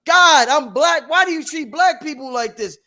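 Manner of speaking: loud, high cry up to God; screaming plea. Acted. A man screaming to God about his suffering, pleading loudly. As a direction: holler it out in anguished mock protest. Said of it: "we're screaming".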